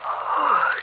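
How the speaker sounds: big sleepy yawn. Acted. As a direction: stretch out a yawn. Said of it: "why I'm yawning like this".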